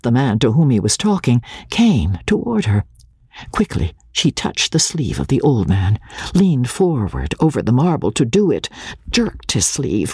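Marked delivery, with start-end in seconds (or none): none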